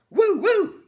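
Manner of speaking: very high, loud answering hoot; echoing woo woo. Retold or imitated. Arnold echoing the patient with a loud high woo. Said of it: "And Arnold goes".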